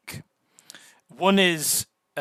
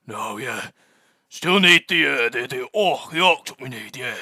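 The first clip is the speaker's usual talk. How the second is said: louder, eager dwarf; 7 dB louder than usual. Acted. Dwarf tells the doctor they still need the orc, loud. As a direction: blurt it out keenly, a gruff dwarf. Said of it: "the dwarf kind of goes".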